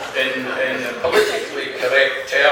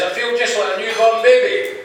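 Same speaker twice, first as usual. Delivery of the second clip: put-on high, lilting old man's answer; chipper old man; 9 semitones higher than usual. Old Slim answers his friend's question, high and chipper. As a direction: declare it proudly in a higher voice.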